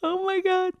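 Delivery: high, loud, breaking cry of disbelief; tearful. Retold or imitated. Medium thanked by her dog through the medium, crying out. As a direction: voice cracking as the tears come. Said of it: "I just start fucking bawling".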